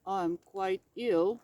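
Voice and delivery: low, slow, sorry-for-himself bear; sick bear. A narrator's reading. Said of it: "Bear reminded him".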